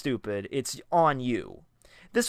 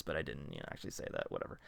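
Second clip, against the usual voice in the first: whispered, trailing off; whispered aside; 9 semitones lower, 14 dB quieter, much faster than usual. Real, not acted. Narrator confiding to listeners, whispered aside. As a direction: drop to a near whisper. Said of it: "I was gonna say".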